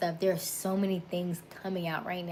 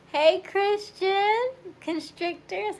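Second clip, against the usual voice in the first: very high, loud, drawn-out hello; excited greeting; 14 semitones higher, 7 dB louder, slower than usual. Real, not acted. Host greeting a viewer in the live stream, excited high call. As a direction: sing out the greeting, slow and high.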